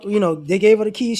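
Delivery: normal talking voice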